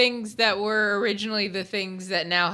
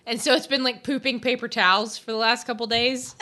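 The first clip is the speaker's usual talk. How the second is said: ordinary talk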